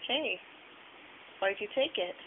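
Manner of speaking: usual speaking voice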